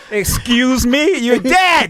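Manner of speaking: slow, loud drawn-out threat; mock tough threat. Joking with his friend, a slow loud mock threat. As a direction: play the hard man staring someone down. Mock confrontation over an accident.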